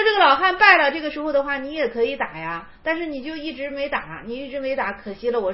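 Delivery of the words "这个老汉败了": higher, louder scolding; 高声斥责; raised verdict. Acted. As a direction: declare the old man beaten, 败了 punched.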